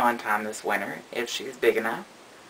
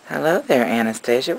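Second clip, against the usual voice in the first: ordinary talk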